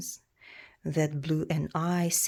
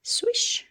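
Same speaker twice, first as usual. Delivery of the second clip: whooshing swish; 4 dB louder than usual. Acted. Sound effect of dragon swooping.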